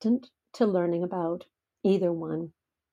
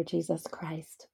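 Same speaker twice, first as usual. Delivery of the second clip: whispered slowly; reverent whisper; 2 semitones lower, 7 dB quieter, slower than usual. Acted. Mother voicing God's words to her, a slow reverent whisper. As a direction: breathe the name in awe. Reverent close of divine message.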